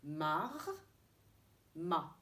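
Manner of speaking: "mar" with r clearly sounded, then "ma" with open a; teacher asking pupils to listen to the difference. Shown as a demonstration. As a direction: say "mar" and "ma" so the r stands out. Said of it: "écoutez-moi".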